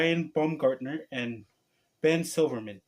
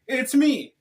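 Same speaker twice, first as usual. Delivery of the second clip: high, very slow cartoon hero voice, much louder; Son Goku impression; 10 semitones higher, 7 dB louder, much slower than usual. Announcing himself to viewers as an anime hero, high and slow. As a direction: puff up as a spiky-haired anime fighter. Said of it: "Just kidding".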